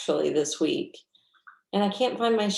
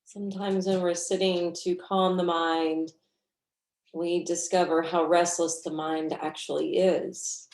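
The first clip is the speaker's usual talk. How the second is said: guided meditation. Real, not acted. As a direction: guide them gently through the breath, unhurried.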